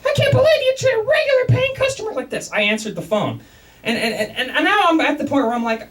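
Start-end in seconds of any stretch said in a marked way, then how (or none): 0.1-2.5 high, shrill-pitched indignant wife; complaining wife. Retold or imitated. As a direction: play the outraged wife, pitched way up. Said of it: "His wife calls back up and complains".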